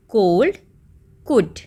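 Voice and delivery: कोल्ड with a clear L, then कुड with the L silent; pronunciation contrast. Shown as a demonstration. Teacher contrasting cold and could for the class. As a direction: sound the L in कोल्ड, drop it in कुड. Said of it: "इसको हम कैसे pronounce करते हैं कुड को".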